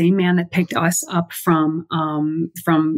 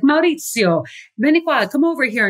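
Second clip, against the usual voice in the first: calling a coworker; 8 semitones higher than usual. Retold or imitated. Airport worker summoning colleague to help, called out higher. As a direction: call him over urgently. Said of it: "She's like".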